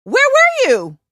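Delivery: loud, high-pitched demand; confronting. Retold or imitated. Performer just off stage to the absent colleague, confronting. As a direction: hit it high and loud, drop at the end. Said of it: "first thing I say to her is".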